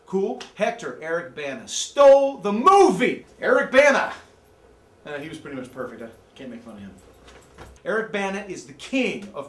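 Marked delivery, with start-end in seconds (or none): 1.7-4.1 high, loud, slow praise; loud gushing fan; excited rave. Real, not acted. Raving about Eric Bana. 5.1-6.9 softer, lower aside; quiet concession. Real, not acted. Admits Bana is perfect.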